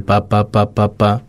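pa pa pa held on one steady pitch; same note. Shown as a demonstration. Guest showing babies keep one note, monotone babble. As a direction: babble on a single unchanging note. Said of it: "Mantengo la misma, la misma nota".

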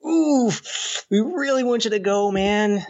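high, mocking company voice; mock boss voice. Mimics company asking him to go.

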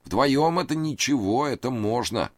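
high, anxious pleading man; nervous high voice. A narrator's reading. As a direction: raise the pitch, plead nervously. Reid voiced.

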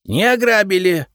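громкий протестующий крик, выше; выкрикнуть с обидой. A narrator's reading. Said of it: "закричал Репей". (возмущённый выкрик)

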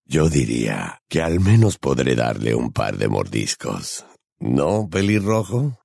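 very low, taunting werewolf; sly predatory purr; Greyback's honeyed voice. A narrator's reading. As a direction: sink deep and savour each word, slowing at the end. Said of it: "Greyback con voz melosa".

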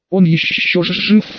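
high hissing demon voice; hissing demoness. A narrator's reading. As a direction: hiss it out like a demoness. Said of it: "прошипела демоница".

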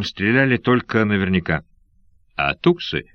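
ordinary delivery, nothing marked

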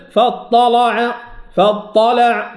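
"فاطلع" with thick L and back A; heavy, thickened lam. Shown as a demonstration. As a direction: chant "فاطلع" slowly with a dark L. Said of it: "الأزرق هنا فخم اللام".

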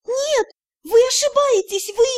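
high-pitched objection; flustered protest. A narrator's reading. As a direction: protest helplessly, voice jumping up. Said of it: "Пытаюсь хоть как-то воспротивиться". (растерянный высокий протест)

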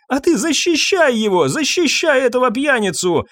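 high-pitched hysterical scolding; distraught, accusing mother; furious reproach. A narrator's reading. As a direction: throw it back bitterly, voice pitched right up. Hysterical mother rebukes daughter.

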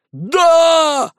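very high drawn-out roar; long rallying shout; crowd yelling. A narrator's reading. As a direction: yell yes like a whole crowd. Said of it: "орала толпа".

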